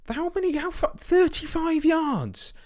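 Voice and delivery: high stretched-out astonished cry; amazed commentary. Real, not acted. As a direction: call the wonder goal in awe. Watching a long-range goal.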